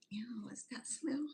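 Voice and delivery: hushed, mostly voiceless "ew" of a neighbor; imitated disgust. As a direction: recoil from a smell under the breath. Imitating people disgusted by the smell.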